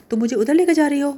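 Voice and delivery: high, loud alarmed disbelief; alarmed surprise. Acted. Haya startled that Hale is taking her to her aunt, high and loud. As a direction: blurt it out in shock. Haya learns where she is going.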